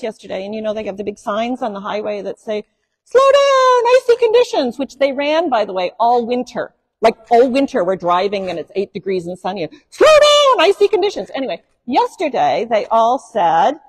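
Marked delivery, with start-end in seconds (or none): 3.0-4.8 high, loud mock announcement of the warning sign; mock highway sign. Preacher mocking the winter highway sign with a high, loud mock warning. 9.9-11.3 high, loud mock announcement of the warning sign; mock highway sign. Preacher mocking the winter highway sign with a high, loud mock warning.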